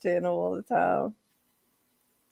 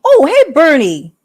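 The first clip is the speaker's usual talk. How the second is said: loud greeting; 4 semitones higher, 11 dB louder than usual. Real, not acted. Greeting a chat viewer.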